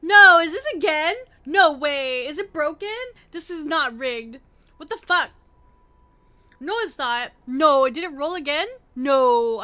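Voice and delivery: loud, shocked outburst; real disbelief. Real, not acted. Streamer reacting to a repeated roll, loud disbelief. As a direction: burst out in stunned disbelief.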